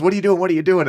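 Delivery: loud, high mock outcry; alarmed retort. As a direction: imitate an appalled casting director yelling. Casting director reacting to vomiting.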